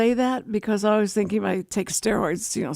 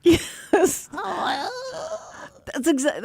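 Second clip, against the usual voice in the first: higher, drawn-out half-sobbing cry; mock crying wail; 9 semitones higher, much slower than usual. Answering the host's crypt joke with a mock wail. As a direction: play up how awful you sound. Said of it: "talking from, you know, the crypt".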